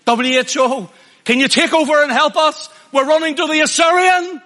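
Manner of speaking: high, loud, pleading cry for help; mock desperate plea. Preacher mocking a nation begging world bodies for help, loud and high.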